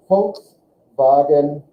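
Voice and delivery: slow "Volkswagen" as "folks-vagen"; German pronunciation. Shown as a demonstration. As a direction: spell out "Volkswagen" slowly, German F and V. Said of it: "You applied these rules and you pronounced it".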